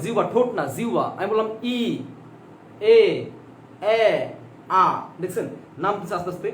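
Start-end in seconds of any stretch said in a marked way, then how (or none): 2.9-4.0 এ and এ said alone with long pauses as the tongue drops; isolated Bengali vowels এ এ for the tongue; vowel demo. Shown as a demonstration. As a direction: sound এ, then এ, slowly and apart. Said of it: "দেখেন জিহ্বা খেয়াল করবেন".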